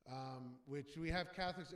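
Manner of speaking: usual speaking voice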